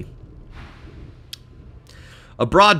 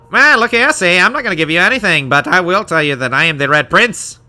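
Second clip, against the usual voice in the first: higher, louder put-on voice, quick in the middle; Red Prince character; 7 semitones higher, 8 dB louder than usual. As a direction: play a haughty prince refusing questions.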